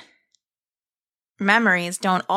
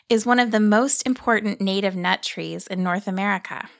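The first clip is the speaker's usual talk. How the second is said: plain reading voice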